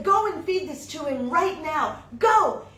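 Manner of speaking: shouted order, rising to a yell; loud command. Acted. Wife orders husband to take the butter, shouting. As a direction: send him off with a sharp shout.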